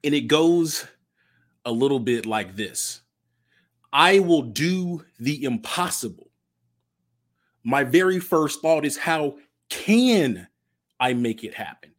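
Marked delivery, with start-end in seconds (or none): 3.9-9.0 pledge recital. Acted. Recites the Goody Nation Pledge. 9.0-11.9 "can" leaned on, the rest falling away; stress on can. Shown as a demonstration. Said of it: "Emphasis on the can".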